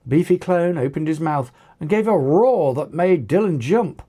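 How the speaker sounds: usual speaking voice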